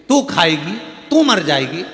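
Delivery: bold offer. Acted. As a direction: push the offer plainly and firmly. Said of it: "गुरु जी बोले".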